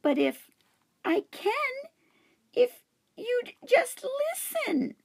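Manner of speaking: a tiny hamster begging to be heard; pleading hamster voice. Acted. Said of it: "I told her".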